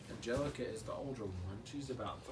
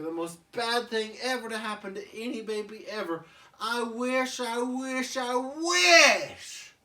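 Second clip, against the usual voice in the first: higher, louder chant building; building wish; 9 semitones higher, 10 dB louder than usual. Acted. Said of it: "Tommy's like".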